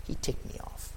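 breathy hushed aside, low and soft; sulky whispered complaint; hushed grumble. Acted. Judas grumbling reason.

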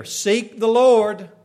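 loud, slow proclamation; preacher declaring at full force. Acted. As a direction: proclaim it to the whole church. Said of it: "We can declare".

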